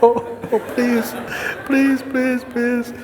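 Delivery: high, squealed string of pleas; mock tearful begging. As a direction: plead hammed-up, almost whimpering. Man refuses, begging.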